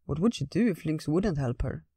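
ordinary delivery

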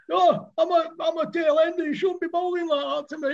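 high, pleading batsman voice; pleading tail ender. Retold or imitated. Tail ender begging the fast bowler, high pleading voice. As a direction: beg the bowler to go easy on you.

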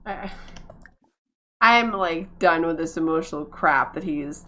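ordinary talk

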